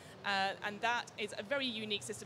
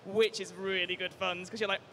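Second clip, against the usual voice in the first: ordinary delivery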